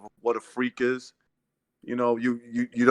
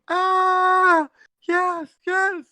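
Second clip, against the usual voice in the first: screaming woman fan; much slower than usual. Retold or imitated. Ex-sister-in-law cheering Holyfield, ecstatic high squeal. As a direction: squeal like she's climaxing. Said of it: "she starts screaming".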